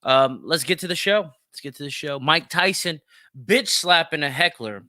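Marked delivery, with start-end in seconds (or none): none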